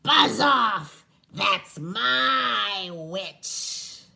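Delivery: monster roar. Acted. The beast warning off the dragon, a loud slow roar. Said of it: "it said to the dragon".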